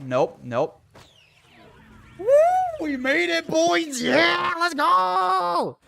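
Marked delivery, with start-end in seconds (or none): 2.2-5.8 high, loud triumphant yelling; victory shout. Real, not acted. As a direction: celebrate the escape, shouting. Escaped, celebrating.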